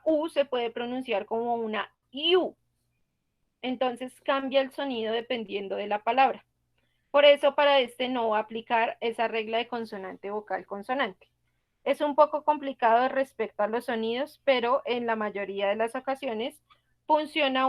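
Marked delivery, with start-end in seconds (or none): none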